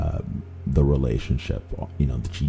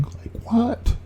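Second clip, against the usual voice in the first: incredulous; 4 dB quieter than usual. Retold or imitated. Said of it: "And I'm like".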